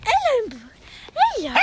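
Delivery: repeated high cooed hellos; high pet greeting. Real, not acted. Greeting a horse.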